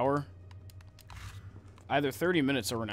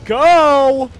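tense shouting; 5 semitones higher, 11 dB louder, much slower than usual. Real, not acted. Hiding then fleeing enemy.

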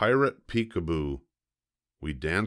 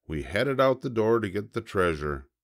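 ordinary delivery, nothing marked

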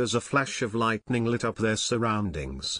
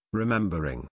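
ordinary delivery, nothing marked

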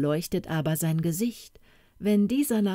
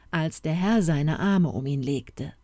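plain reading voice

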